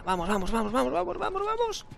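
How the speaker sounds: rising into a squeaky panic, quiet; frantic urging. Real, not acted. Desperate escape in game.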